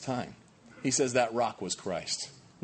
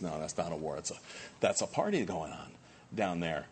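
quiet God voice; 7 semitones lower, 7 dB quieter than usual. Retold or imitated. God voiced in retold story.

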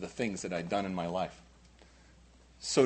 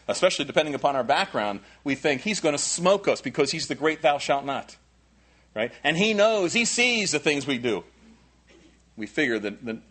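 ordinary talk, nothing marked

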